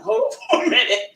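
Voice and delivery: high, laugh breaking through the words; laughing quote. Retold or imitated. Imitating brother on a work call, laughing. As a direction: quote it while cracking up.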